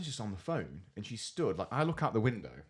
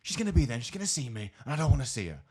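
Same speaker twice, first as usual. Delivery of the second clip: higher, exasperated mimicked complaint; aggravated; 6 semitones higher, 3 dB louder than usual. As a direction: imitate her fretful phone rant.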